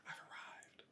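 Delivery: breathy whisper, no voice at all; ASMR whisper. Acted. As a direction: whisper it like a secret. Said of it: "Brown just whispers".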